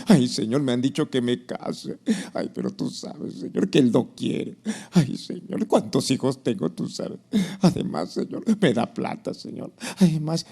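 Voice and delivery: mock pleading believer, low and sighing; hammed-up plaintive prayer full of excuses; excuse-making prayer. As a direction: beg God to understand, fading on each Señor. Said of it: "cambia esa oración de excusas".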